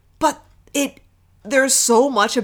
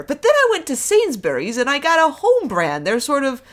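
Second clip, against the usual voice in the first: lightly imitated batty verger, pitched up; Alice Tinker; 7 semitones higher than usual. Batty vicar's assistant rambling about store brands, lightly imitated. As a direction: ramble on befuddled in a higher voice. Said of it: "Alice just has this whole thing".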